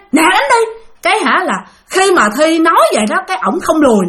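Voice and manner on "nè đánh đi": high, sharp re-enacted challenge; heated retort, pitched way up; angry dare. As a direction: dare him to strike, fed up. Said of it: "Cái Thi tức, cái Thi mới nói".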